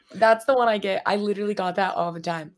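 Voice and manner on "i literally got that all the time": ordinary talk, nothing marked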